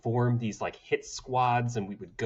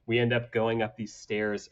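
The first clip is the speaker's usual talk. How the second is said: ordinary delivery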